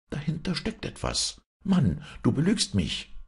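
high-pitched suspicious wife; sharp rise into a high woman's voice; accusing wife. A narrator's reading. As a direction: accuse your husband in a high voice.

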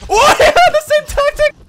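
high, loud burst of laughter; real amused outcry; laughing shout. Real, not acted. Laughing shock at opponent's identical tactic.

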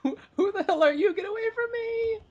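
very high, squeaky comic falsetto voice; mock freaked-out passerby. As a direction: squeal it softly like a panicked stranger.

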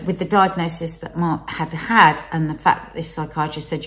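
usual speaking voice

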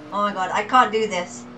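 normal talking voice

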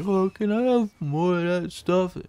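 lightly voiced dazed customer; mock dopey customer. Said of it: "talking like he's fucking overdosing on Xanax".

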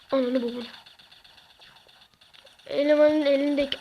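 usual speaking voice

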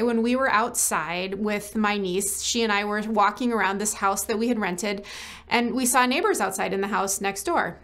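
usual speaking voice

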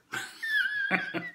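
squeaky high giggle; high cackling laugh. Real, not acted. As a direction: let out a high, delighted cackle. Laughs at end of story.

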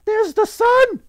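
put-on very high, loud child's cry; young girl's yell. A narrator's reading. Young girl spots the sun in the west, high yell. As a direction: squeal it out like an excited little girl. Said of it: "yelled a young girl".

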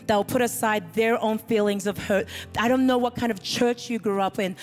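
usual speaking voice

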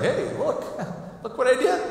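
high, eager boast with a laugh; excited servant. Acted. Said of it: "He's excited".

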